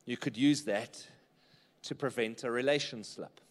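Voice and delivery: usual speaking voice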